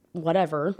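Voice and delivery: ordinary delivery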